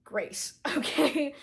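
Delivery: ordinary talk, nothing marked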